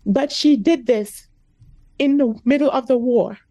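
pushing through real tears, word by word; speaking through real emotion; emotional. Real, not acted. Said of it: "I get really emotional".

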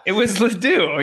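loud, laughing emphasis on Ledoux. Real, not acted. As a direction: blurt it out with a laugh. Laughing confirmation of name.

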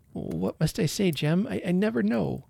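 hesitant worry. A narrator's reading. Shy wife loiters, unsure what to say.

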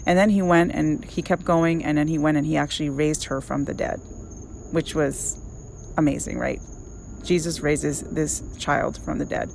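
ordinary talk, nothing marked

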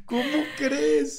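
slow, high incredulous cry; real astonishment. Real, not acted. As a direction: stretch it in scandalized disbelief. Listener reacts in shock.

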